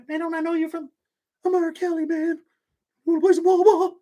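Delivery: very high, silly put-on voice mocking a prisoner gone mad; mock R. Kelly voice. Streamer mocking R. Kelly in prison, high silly voice. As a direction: squeak it out like a man who lost his mind. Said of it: "he might be like".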